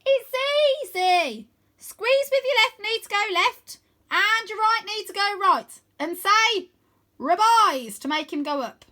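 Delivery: high, loud call with cupped hands; girl calls instructions to boys on a dragon. Acted. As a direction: shout the instructions across the distance. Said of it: "cups her hands round her mouth and calls".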